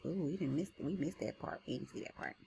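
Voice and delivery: ordinary talk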